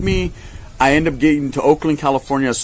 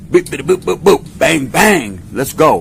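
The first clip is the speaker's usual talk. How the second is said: louder higher beeps and bangs; hammed-up sound effects of a breakout; mock escape noises; 4 semitones higher, 4 dB louder, much slower than usual. Planning the escape at the layover, loud mock beeps.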